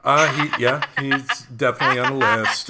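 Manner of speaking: talking through laughter; laughing. Real, not acted. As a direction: laugh while you say it. Laughing while answering.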